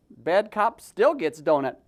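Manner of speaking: ordinary delivery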